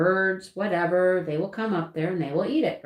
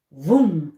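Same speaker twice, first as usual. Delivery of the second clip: loud, low "vroom" burst; zooming noise; 4 semitones lower, 8 dB louder than usual. Retold or imitated. Ducks and chickens race to feeder, loud zoom noise. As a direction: imitate a sudden rush with a vroom. Imitates birds rushing to suet.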